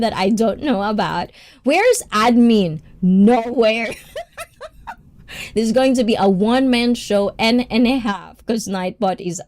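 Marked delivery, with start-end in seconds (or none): 4.0-4.9 squeaky high giggle. Real, not acted. Laughs at being alone.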